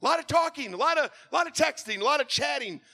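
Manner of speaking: high, loud, driving sermon voice; full-force preaching. Acted. Pastor preaches to congregation.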